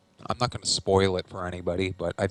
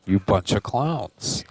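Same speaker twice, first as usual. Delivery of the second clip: loud, showman welcome; announcer intro; 7 dB louder than usual. Acted. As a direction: greet the viewers like a host. Show intro to the audience.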